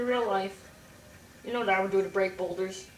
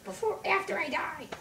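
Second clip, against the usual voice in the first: high falsetto grumbling; squeaky sarcastic complaint, easing down at the end; high-pitched cartoon griping; ranting Link voice; 13 semitones higher, 3 dB louder than usual. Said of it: "Symbol Link comes out".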